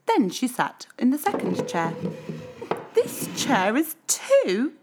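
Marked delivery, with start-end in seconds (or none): 2.9-4.7 high, slow whiny complaint; whining. A narrator's reading. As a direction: whine like a fussy child. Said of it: "she whined".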